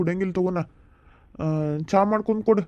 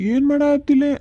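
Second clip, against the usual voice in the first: calling out; 7 semitones higher, 6 dB louder than usual. Acted. Husband calling out to wife.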